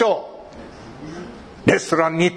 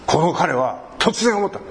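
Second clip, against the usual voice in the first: usual speaking voice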